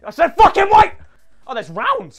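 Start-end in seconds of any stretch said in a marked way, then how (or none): 0.0-0.9 loud, high insistent yell; forceful, raised demand; exasperated shout. Real, not acted. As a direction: shout it after being ignored. Repeats ignored request to wait.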